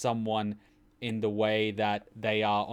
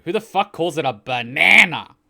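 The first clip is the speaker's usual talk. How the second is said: much louder and higher mock outrage; incredulous shout; 6 semitones higher, 13 dB louder than usual. Real, not acted. Australian ranting about Americans, loud disbelief. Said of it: "mocking American pronunciation".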